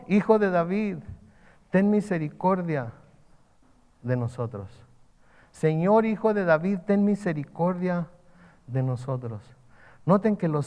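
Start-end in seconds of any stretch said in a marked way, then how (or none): none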